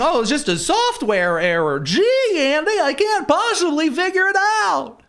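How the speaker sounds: high, slow, mock-innocent whine; high falsetto mocking a clueless official; mock naive voice. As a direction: play dumb in a high sing-song.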